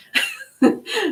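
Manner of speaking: squealing laugh; high laugh at herself. Real, not acted. Reader laughing at herself for missing the card's label. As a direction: laugh off the blunder. Laughs at own blonde moment.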